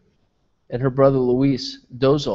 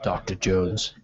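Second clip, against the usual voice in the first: low, softer film-character line; movie-quote voice; 6 semitones lower, 6 dB quieter, much slower than usual. Acted. Film quote after Indiana Jones mention.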